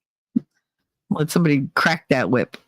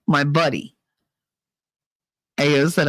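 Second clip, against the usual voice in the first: normal talking voice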